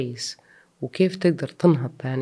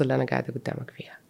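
whispered; 2 semitones lower, 8 dB quieter, faster than usual. Real, not acted. Moved, thanking God.

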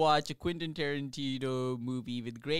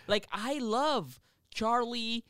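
mock-gushing fan, raised pitch; sarcastic; 7 semitones higher, 6 dB louder than usual. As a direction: sarcastically rave about him. Sarcastic praise of Charles Manson.

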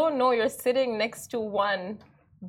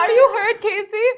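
alarmed; 11 semitones higher, 9 dB louder, faster than usual. Real, not acted. Worried after the punch.

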